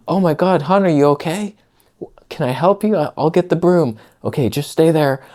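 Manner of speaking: high, sweet and concerned; caring partner. Retold or imitated. To his fiance after broken glass, sweet high concern. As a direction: gush with worry over her. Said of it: "instead of being like".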